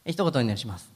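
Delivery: normal talking voice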